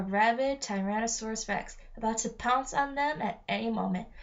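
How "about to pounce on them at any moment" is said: usual speaking voice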